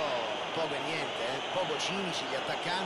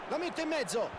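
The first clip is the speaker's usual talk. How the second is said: excited commentary; 9 semitones higher than usual. Acted. Cross into the box.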